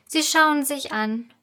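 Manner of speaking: usual speaking voice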